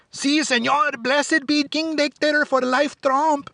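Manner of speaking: put-on high, slow, cowering thick Mexican accent; high timid voice in a put-on Mexican accent. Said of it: "speak in a thick accent".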